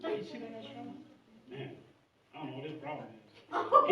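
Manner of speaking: hushed mocking bystander voice; gossiping onlooker. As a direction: mutter it like a judgy bystander. Imitates judgmental onlookers.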